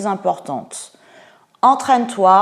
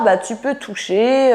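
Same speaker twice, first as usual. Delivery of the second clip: ordinary delivery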